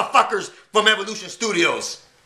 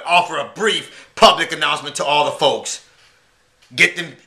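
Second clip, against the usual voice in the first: ordinary talk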